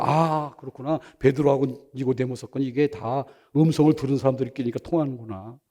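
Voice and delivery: low, soft musing to oneself; hushed retold inner thought; quiet realization. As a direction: let the insight dawn softly. Voices his own dawning realization.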